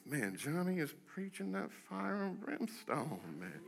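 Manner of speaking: soft, slow-drawn grumble about the preacher; quietly voiced mock congregant comment; murmured audience aside. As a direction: imitate a listener murmuring under the breath. Acting a murmuring listener.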